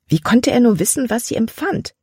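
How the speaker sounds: high, exasperated question; indignant disbelief. A narrator's reading. Libby riled that Caleb reads her feelings, indignant.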